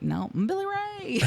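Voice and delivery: high squeaky Southern denial; Southern accent. Retold or imitated. Host mimics [name]'s high Southern denial. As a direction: yelp it out in a Southern twang. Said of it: "the thickest Southern accent ever".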